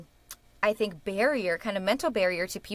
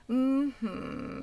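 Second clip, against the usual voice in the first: higher, clear hummed mm-hmm; 4 semitones higher than usual. Shown as a demonstration. Coach echoes student's clear first part, hummed mm-hmm. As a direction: hum it clearly, gliding down. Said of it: "the first part was really clear".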